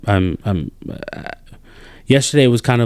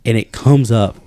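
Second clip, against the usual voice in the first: normal talking voice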